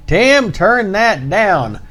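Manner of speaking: lightly voiced mother telling the kids to turn it down; mother's scolding. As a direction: stretch it out like a mom calling down the hall. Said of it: "hear his mother saying".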